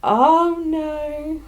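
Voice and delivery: small, drawn-out dread; hammed-up anxious aside; worried inner voice. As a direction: act out a sinking realization. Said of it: "someone's internal voice being like".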